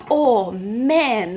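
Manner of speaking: ordinary talk, nothing marked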